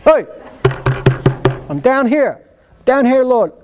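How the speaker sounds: insistent mock cry for mercy. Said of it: "You gotta kick him in the shins".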